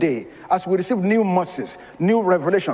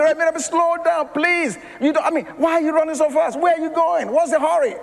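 begging the driver to ease off; pleading with the driver; 6 semitones higher than usual. Retold or imitated. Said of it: "And I said".

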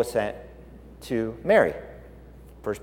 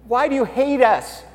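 higher, anguished question to God; pleading to God; 10 semitones higher, 4 dB louder than usual. Retold or imitated. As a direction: ask God why, hurt and begging. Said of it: "Zechariah and Elizabeth were like".

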